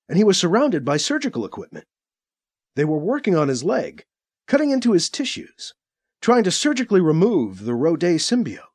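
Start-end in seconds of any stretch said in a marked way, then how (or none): none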